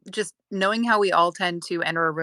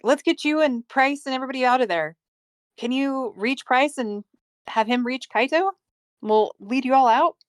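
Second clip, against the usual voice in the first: raised, higher calling voice; 6 semitones higher than usual. Acted. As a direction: call out to him across the dark. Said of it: "so I can kinda yell at him".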